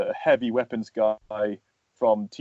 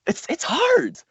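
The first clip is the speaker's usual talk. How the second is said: Winnie the Pooh voice. Retold or imitated. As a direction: slip into a gentle Pooh bear voice. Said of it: "trying to imitate".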